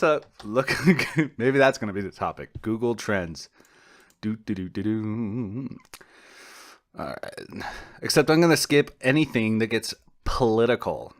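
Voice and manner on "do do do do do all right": ordinary talk, nothing marked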